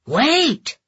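sharp high call to stop; urgent shout. A narrator's reading. Yoshi stops her eating.

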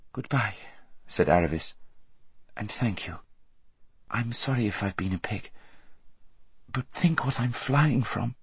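put-on a girl saying a soft, sorry farewell; tender goodbye. A narrator's reading. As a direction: part gently with a friend. Aravis says a soft goodbye.

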